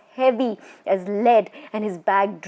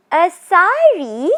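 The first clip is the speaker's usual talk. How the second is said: high, slow, gleeful ghostly cry; a witch delighted by a gift; fearful joyful whoop; 9 semitones higher, 7 dB louder, slower than usual. Acted. Ghost woman thrilled by the promised sari, whooping high and slow. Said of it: "she whooped with fearful joy".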